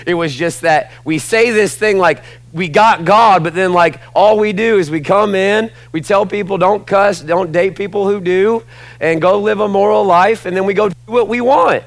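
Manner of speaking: high, loud, driving preacher's list; impassioned sermon voice, sustained and forceful; full-force preaching. Real, not acted. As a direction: hammer each phrase with rising exasperation.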